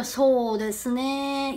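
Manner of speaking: very slow, soft, stretched 소 데스네; pondering. Shown as a demonstration. Interviewee asked an opinion, stalling thoughtfully. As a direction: draw it out while you think. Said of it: "이러면서 생각을 해요".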